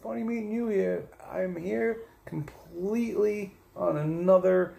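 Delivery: high, loud, hammed-up reply; over-bright polite small talk, pitched high; retold greeting. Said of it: "I was like".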